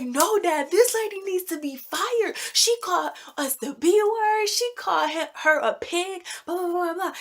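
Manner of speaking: high-pitched acted-out protest; indignant retold complaint. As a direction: tattle to dad, indignant and high. Retells complaint to dad about guard.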